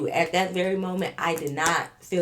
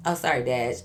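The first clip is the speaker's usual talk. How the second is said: ordinary talk, nothing marked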